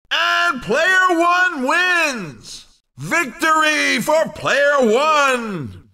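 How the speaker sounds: high, drawn-out victory announcement; slow, excited announcer cry; triumphant winner call. Acted. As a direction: stretch the words out to crown the winner. Announcer calls the race winner.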